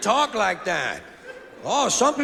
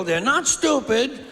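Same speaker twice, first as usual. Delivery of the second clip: usual speaking voice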